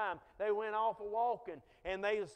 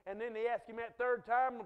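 ordinary talk

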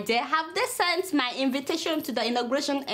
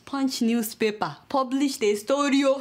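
normal talking voice

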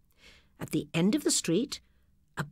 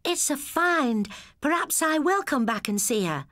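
lightly voiced girl, higher; pleased with the discovery; girl's voice; 9 semitones higher than usual. A narrator's reading. Sister to brother about the shop, light girl voice.

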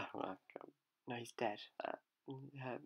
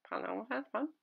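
ordinary talk, nothing marked

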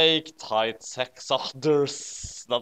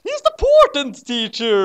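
much higher, louder, put-on squeaky voice; hammed-up shrill cartoon devil; squeaky Satan; 14 semitones higher, 10 dB louder than usual. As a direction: play Satan as a silly high-pitched goth.

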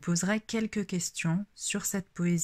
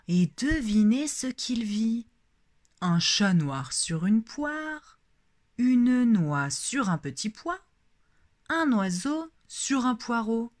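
expressive poem reading, louder stresses on key words; lecture de poésie; 3 dB louder than usual. Shown as a demonstration. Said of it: "faire la lecture de la poésie". (lecture expressive de poésie)

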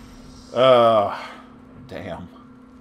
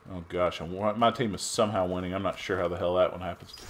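ordinary talk, nothing marked

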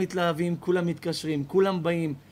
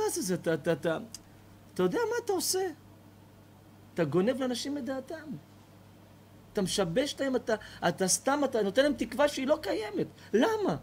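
stammering indignation; 8 semitones higher than usual. Acted. Neighbour berates the old man.

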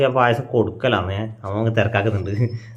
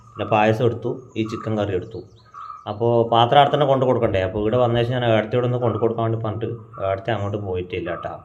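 usual speaking voice

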